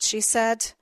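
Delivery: ordinary delivery, nothing marked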